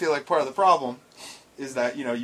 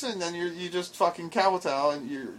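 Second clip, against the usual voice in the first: ordinary talk, nothing marked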